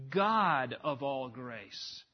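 GOD drawn out long and loud; stress on God. Shown as a demonstration. Preacher showing God comes first in the Greek, stress on God. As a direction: lean hard and slow on "God". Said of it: "put at the very front".

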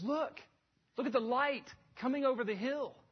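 high, eager call to look; urgent pointing out. Acted. Peter pointing believers to the horizon, urgent and high. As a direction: draw their eyes to something wonderful ahead.

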